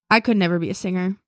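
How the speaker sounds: ordinary delivery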